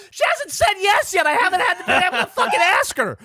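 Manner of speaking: high, strained protest to a crowd; exasperated shout. Retold or imitated. Proposer shouting back at premature well-wishers, exasperated. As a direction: yell back high and frustrated.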